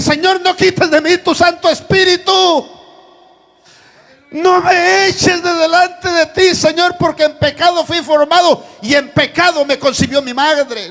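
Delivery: loud, high preaching, half-sung at the peaks; full-force preaching. Acted. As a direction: plead David's prayer like a weeping king. (predicación a todo pulmón con tono cantado)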